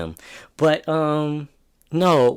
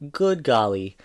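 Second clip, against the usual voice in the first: ordinary talk, nothing marked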